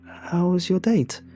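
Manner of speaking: high, slow, awkward question; changing the topic. A narrator's reading. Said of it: "I tried changing the topic".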